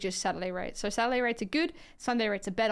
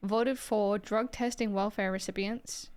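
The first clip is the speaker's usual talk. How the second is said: ordinary talk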